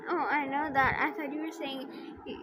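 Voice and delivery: ordinary delivery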